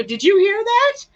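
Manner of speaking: put-on retelling asking the little boy in the hallway, startled; startled question. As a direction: ask it like you can't believe your ears. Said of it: "and I go".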